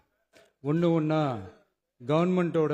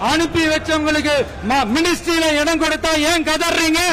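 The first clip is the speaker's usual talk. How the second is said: loud, forceful rally speech; full-force stump speech; fiery political oratory; 11 semitones higher, 9 dB louder than usual. Real, not acted. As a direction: hurl each line out to a huge crowd.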